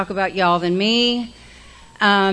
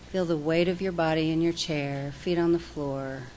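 guided breathing; 4 dB quieter than usual. Acted. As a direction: ease the room into calm breaths, softly. Leads breathing exercise.

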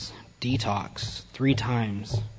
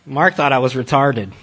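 usual speaking voice

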